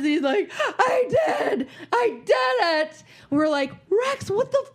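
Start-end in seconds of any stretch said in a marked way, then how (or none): 0.6-3.0 high, wailing I did it; sobbing confession. Retold or imitated. Son confesses to his parents, crying out. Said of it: "burst into tears". 3.9-4.6 retold parent shouting at the kid; exasperated yell. Mother confronting son about purchases, shouting in disbelief.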